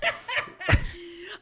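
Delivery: high, loud burst of laughter; big laugh. Real, not acted. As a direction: crack up at him. Laughing at friend's retraction.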